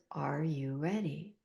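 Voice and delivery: soft, slow, kind question; a gentle, slow invitation; gentle and loving. Retold or imitated. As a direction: ask it like a loving presence. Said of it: "they were so kind and gentle and loving".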